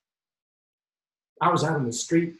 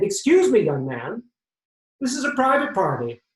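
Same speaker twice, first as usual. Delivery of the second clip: put-on offended old man; 7 semitones higher, 5 dB louder than usual.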